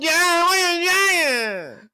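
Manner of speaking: put-on high, slow, tearful child voice; mock hysterical kid crying the question; sobbing child. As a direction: wail it like a crying toddler.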